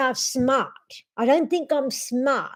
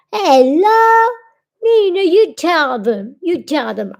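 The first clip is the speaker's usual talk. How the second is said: loud, higher, bright hello; excited greeting; 3 semitones higher, 7 dB louder than usual. Real, not acted. Owner excitedly greeting viewers with her dog. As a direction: burst out with a cheery hello.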